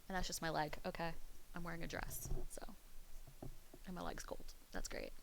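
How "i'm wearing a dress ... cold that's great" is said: soft whispered aside. Real, not acted. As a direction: drop to a whisper. Whispered aside about her outfit.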